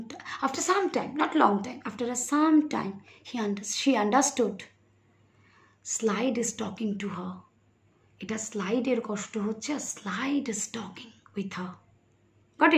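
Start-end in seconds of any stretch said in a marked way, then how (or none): 6.4-11.7 hushed whispered reveal. Real, not acted. Teacher whispering the secret to students.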